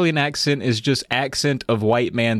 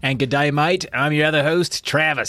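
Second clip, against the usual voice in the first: higher-pitched put-on Australian host intro; Australian accent; 5 semitones higher than usual. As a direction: greet listeners in an Australian accent. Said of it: "jump right in with that Australian accent".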